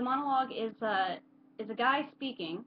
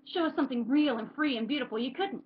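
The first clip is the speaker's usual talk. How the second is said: mildly raised, easing after the outburst; bitter challenge; 5 semitones higher, 5 dB louder than usual. Acted. Character daring the crowd to show something real, subdued bitterness. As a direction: dare them, then let the force drop away. End of the performed monologue.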